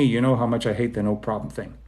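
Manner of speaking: ordinary delivery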